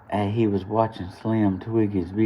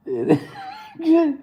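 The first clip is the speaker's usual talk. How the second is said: laughing near crying, very high; helpless high laugh; high squealing laugh; 3 dB louder than usual. Real, not acted. Laughing at dad.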